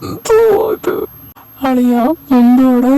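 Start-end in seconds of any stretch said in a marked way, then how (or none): 0.1-0.9 higher, crying whine; whiny crying. Acted. Sulking friend whining in a mock cry. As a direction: wail it like a sulking kid.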